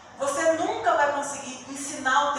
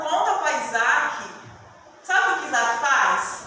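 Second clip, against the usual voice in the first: loud, higher impassioned sermon; fervent preaching; 5 semitones higher, 6 dB louder than usual. Acted. Pastor preaching to the congregation about innocence, fervent. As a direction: preach it out with fire.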